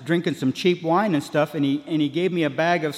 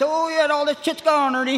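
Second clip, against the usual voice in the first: much higher, wired, cheerful admission; tossing it off like nothing happened; giddy high; 11 semitones higher than usual. Retold or imitated. Admitting to Ernie the drugs are gone, giddy. Owning up, still high on meth.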